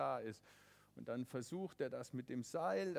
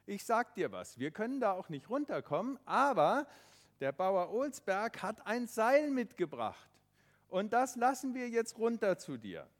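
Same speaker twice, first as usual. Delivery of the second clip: lightly voiced father calling down, louder; 5 semitones higher, 10 dB louder than usual. Father calling down the cliff to his son, reassuring. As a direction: reassure the boy from above. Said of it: "der Papa sagt zum Tobi".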